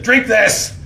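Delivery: angry prophet forcing the people to drink; stern command. Acted. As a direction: order them to drink it.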